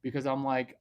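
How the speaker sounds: ordinary talk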